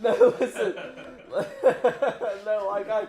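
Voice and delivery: loud, high laughing protest. Real, not acted. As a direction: laugh through the words. Laughing after insulting friend.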